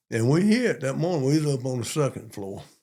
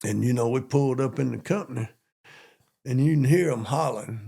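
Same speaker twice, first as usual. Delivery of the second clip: ordinary talk, nothing marked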